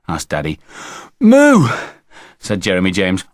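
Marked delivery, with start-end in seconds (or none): 1.2-1.7 put-on high, loud boyish moo; child's loud cow imitation; demonstrated moo. A narrator's reading. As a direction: belt out a short moo. Boy demonstrates his moo.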